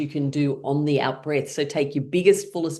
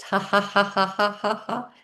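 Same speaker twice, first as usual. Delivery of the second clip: evenly spaced, higher ha-has; deliberate pitched-up exercise laugh; laughing sounds; 6 semitones higher than usual. Shown as a demonstration. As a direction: chant fake laughter while tapping. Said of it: "as you're making laughing sounds".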